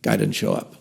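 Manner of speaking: ordinary delivery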